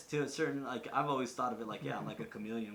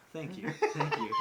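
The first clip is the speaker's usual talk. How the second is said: higher, louder gushing thanks with a laugh; beaming delight; 8 semitones higher, 6 dB louder than usual. Retold or imitated. Thanking someone who took him for a local, beaming mock delight. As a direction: gush as if hugely flattered, hammed up. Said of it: "I was, like, beaming".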